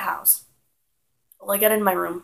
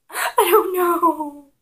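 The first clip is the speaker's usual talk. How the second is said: high, loud, drawn-out mock cry; imitated wail; 10 semitones higher, 10 dB louder, much slower than usual. Hurt child answering Aunt Joyce, loud high mock wailing. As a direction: wail it slowly like a panicking child.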